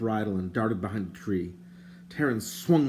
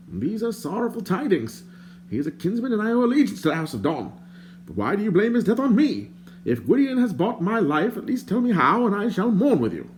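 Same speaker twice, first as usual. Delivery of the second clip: higher, prim and indignant stranger; lightly voiced fussy stranger; 11 semitones higher, 3 dB louder than usual. A narrator's reading. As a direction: play the fussy bard, put out. The bard's voice.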